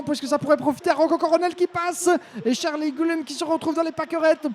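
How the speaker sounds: high, rising race call; excited motorsport commentator. Real, not acted. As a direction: call the chaos as it unfolds. Rivals collide, positions lost.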